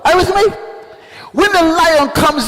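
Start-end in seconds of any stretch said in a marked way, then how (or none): none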